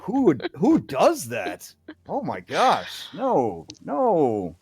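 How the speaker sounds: high, loud, scandalized guard; flustered, embarrassed shock. Acted. Guard refusing to chain up a visitor, mortified. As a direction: recoil in loud, swooping disbelief. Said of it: "like, really confused and embarrassed".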